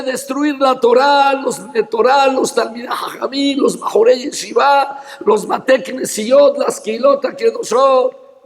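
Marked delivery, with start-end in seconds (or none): none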